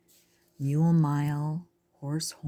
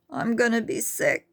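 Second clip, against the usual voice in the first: queasy hyena; 6 semitones higher than usual. A narrator's reading. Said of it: "said another".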